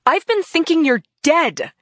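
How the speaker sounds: high, loud cry of hurt; shouted. Acted. Wife to missing husband, shouting in hurt. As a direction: shout it at him, raw. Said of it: "I shouted".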